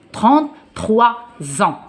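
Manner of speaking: "trente-trois", a beat, then "ans" as "zan"; pronounced with Z. Shown as a demonstration. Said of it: "ça prononce Z". (S prononcé Z, détaché : « trente-trois ans »)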